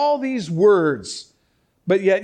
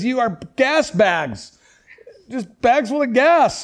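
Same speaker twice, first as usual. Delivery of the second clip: slow mocking "You are gas bags"; sarcastic; 5 semitones higher, 4 dB louder, slower than usual. Paul mocking the proud church, slow sarcastic jab. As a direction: drag it out, scoffing at them. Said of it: "Paul's using sarcasm here".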